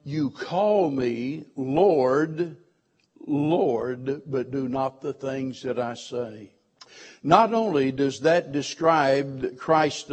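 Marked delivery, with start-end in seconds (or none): 0.0-6.5 drawn out, slow and deliberate reading; slow solemn scripture quote; slow quoted scripture. Retold or imitated. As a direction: read the Lord's words slowly and gravely. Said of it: "readily accepts that title".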